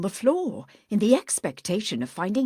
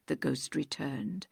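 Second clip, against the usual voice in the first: ordinary talk, nothing marked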